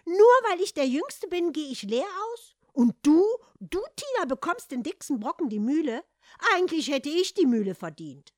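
shrill, loud, high outburst; ranting. Acted. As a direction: throw a jealous tantrum. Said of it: "Doch der zeterte weiter".

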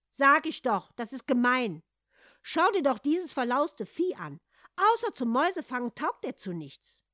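loud, high scornful complaint; angry complaint. Acted. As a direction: sneer at the cat. Hans keeps quarrelling.

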